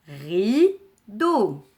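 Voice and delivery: "Rideau" in two loud, long, separated syllables. Shown as a demonstration. Teacher puts rice and back together, syllable by syllable. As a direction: stretch "Rideau" into ri, pause, deau. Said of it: "composé de deux syllabes".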